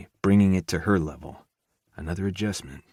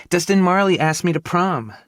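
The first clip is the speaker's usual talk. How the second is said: high, louder excited squeak; squeaking teen girl; 12 semitones higher, 7 dB louder than usual. A narrator's reading. Younger sister telling brother about prom, excited squeak. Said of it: "she squeaked".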